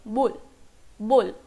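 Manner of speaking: "बुल" with a b sound at the start. Shown as a demonstration. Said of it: "ब वाला sound आ रहा है". ("बुल", ब की आवाज़ से)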